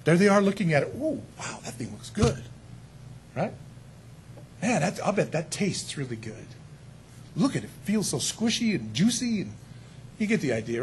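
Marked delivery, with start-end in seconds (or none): 1.0-9.4 soft, hushed craving voice; tempted Eve. Retold or imitated. Eve gazing at the forbidden fruit, hushed and tempted. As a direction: whisper your desire for the fruit, lightly.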